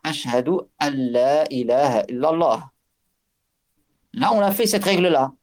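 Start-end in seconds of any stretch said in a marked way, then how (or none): 0.0-2.7 "ashhadu an la ilaha illallah" with the noun merged into the lam; correct tajwid pronunciation. Shown as a demonstration. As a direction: run "an la" together as "al-la", no n heard. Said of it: "donc la bonne prononciation avec le tajwid". ("ashhadu an la ilaha illallah" avec le noun assimilé au lam)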